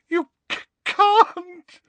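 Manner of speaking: very high wailing howl. Acted. Trelawney howling at Umbridge through tears, high and wailing. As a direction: wail it out in tears. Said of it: "howled Professor Trelawney".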